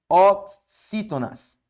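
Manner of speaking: "Oxítonas" slow, first syllable held apart; teacher slowly sounding out "Oxítonas"; drawn-out key term. Shown as a demonstration.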